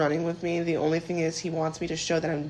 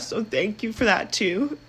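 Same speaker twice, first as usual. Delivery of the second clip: ordinary talk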